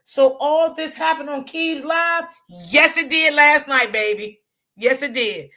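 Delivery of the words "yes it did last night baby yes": ordinary delivery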